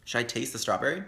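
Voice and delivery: usual speaking voice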